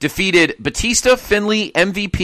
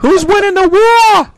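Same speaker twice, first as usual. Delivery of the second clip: very high, loud, drawn-out yell; over-the-top mock cry; 8 dB louder, slower than usual. Host yelling to laughing co-hosts about the war, hammed-up triumph.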